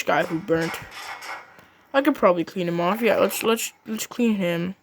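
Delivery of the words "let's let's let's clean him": usual speaking voice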